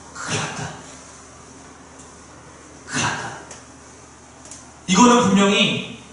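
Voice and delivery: hushed, almost voiceless mock panic; retold trembling dread. Israel's king reacts to Naaman's letter, acted trembling dread. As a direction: imitate a frightened king murmuring under his breath. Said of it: "하는 것이다 라고 하면서 벌벌 떱니다".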